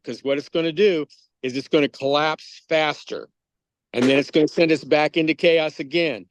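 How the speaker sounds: usual speaking voice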